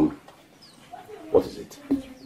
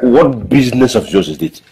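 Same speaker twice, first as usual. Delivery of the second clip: loud, irritated challenge; angry retort; 7 dB louder than usual. Acted. Drinker telling a meddler it is none of his business, angry. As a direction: snap back loudly at the meddler. Snaps back at nosy onlooker.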